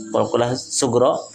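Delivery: usual speaking voice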